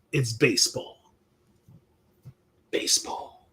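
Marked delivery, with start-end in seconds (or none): 2.7-3.5 whispered aside. Pretend. Mock answer after saying it.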